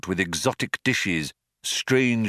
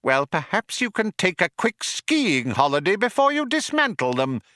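high, acidly mocking mouse; 11 semitones higher than usual. A narrator's reading. Mouse mocks old man's glaciers, acidly. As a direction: needle him in a high mouse voice. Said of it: "said Frankie acidly".

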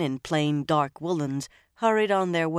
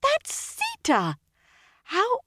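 very high, slow, squeaking shock; slower than usual. A narrator's reading. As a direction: squeak out the name in horror. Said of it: "she gave an abrupt squeak".

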